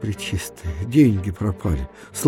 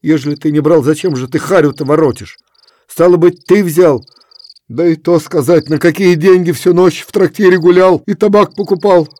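higher, loud stern scolding; stern reproach; 6 semitones higher, 7 dB louder than usual. A narrator's reading. Efrem reproaches Kuzma, loud stern scolding.

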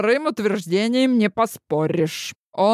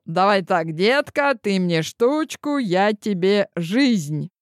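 usual speaking voice

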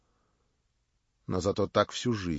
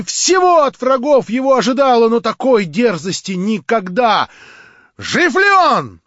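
much higher, louder, proud; Marya, defiant; 12 semitones higher, 14 dB louder than usual. A narrator's reading. Marya scorns her would-be prince, high and loud. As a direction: cry it out with haughty scorn.

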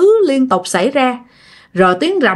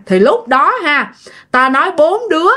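ordinary delivery

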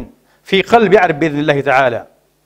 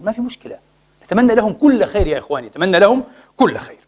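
ordinary talk, nothing marked

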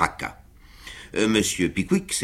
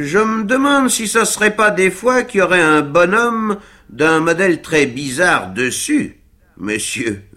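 higher, louder sly servant's quip; teasing Sam; 9 semitones higher, 5 dB louder than usual. A narrator's reading. Sam teasing Winkle about his wobbling, sly and loud. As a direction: poke fun at his master politely. Sam's wry teasing reply.

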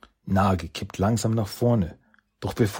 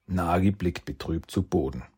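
ordinary delivery, nothing marked

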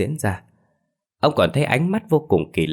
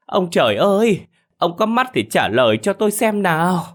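much higher and louder shrill wail to the village; wailing lament; 9 semitones higher, 3 dB louder than usual. A narrator's reading. Bà Hảo wailing to the neighbours, much higher and louder. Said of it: "liên tục gào ầm cả lên".

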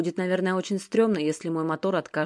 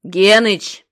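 loud, higher, cut short; sharp rebuke; 4 semitones higher, 12 dB louder than usual. A narrator's reading. Kiryukha snapping at chatty Genych to stop talking. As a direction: snap his name to shut him up.

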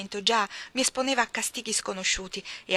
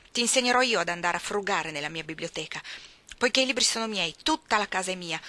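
bullying tirade; 4 dB louder than usual. Acted. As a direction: lord it over her with contempt. John Reed's long tirade. (sfuriata sprezzante da ragazzo viziato)

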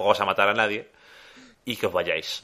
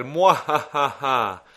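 slow, drawn-out evil laugh; evil villain cackle; 5 semitones higher, 4 dB louder, much slower than usual. Retold or imitated. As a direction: cackle like a cartoon baddie.

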